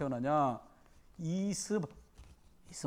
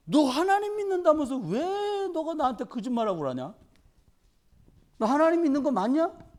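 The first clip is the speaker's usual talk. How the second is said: king scolding; 7 semitones higher than usual. Acted. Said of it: "왕이 얘기합니다".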